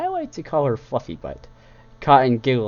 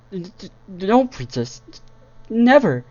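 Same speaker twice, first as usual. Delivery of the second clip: frightened stammer; 10 semitones higher, 5 dB louder, slower than usual. Acted. Frightened mare stammers denial.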